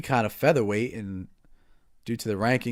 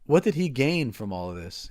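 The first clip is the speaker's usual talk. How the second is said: normal talking voice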